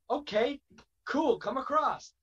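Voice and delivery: high-pitched, easygoing okay; border officer. Acted. Border officer letting a wrestler cross, high-pitched and easygoing.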